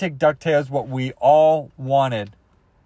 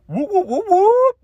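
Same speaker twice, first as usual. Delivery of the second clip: very high imitated train whistle, rising louder; train whoops; 4 dB louder, slower than usual. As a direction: hoot like a cartoon train, climbing in pitch. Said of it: "That's how the DuckTales train sounds".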